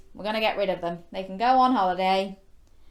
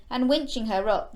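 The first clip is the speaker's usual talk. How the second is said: ordinary delivery, nothing marked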